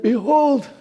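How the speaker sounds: a frail blind father giving orders; old Isaac. Acted. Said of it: "He said".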